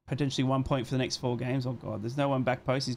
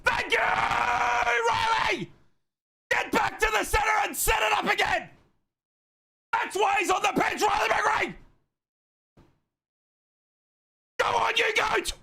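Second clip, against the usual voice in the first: real full-voiced screaming, pitch far above usual; angry celebration. Real, not acted. Host celebrates Riley's goal after a poor half, angry screaming. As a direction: scream the goal home in furious joy. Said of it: "most angriest I've ever been celebrating".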